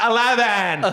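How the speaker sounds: put-on a high, loud party-girl reply; mock drunk girl answer. Quoting a drunk Broadway video girl, high loud answer. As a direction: blurt it like a tipsy video star. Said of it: "she's like".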